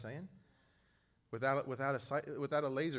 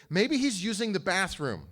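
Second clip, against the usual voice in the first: mocking taunt; 7 semitones higher, 9 dB louder than usual. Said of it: "Elijah literally says that".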